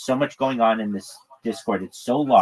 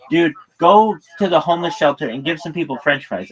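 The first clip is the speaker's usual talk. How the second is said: raised, louder jeering demand; mock heckler shout; 6 semitones higher, 6 dB louder than usual. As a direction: bark it like a pushy heckler. Troll demanding in retold chat.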